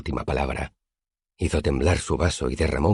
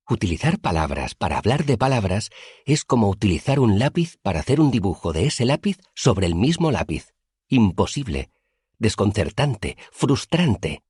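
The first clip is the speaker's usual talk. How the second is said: rising higher with growing frustration; excited teacher voice; 6 semitones higher than usual. A narrator's reading. Elodin exasperated explaining names to the student, excited higher voice. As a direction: Elodin wound up, voice climbing.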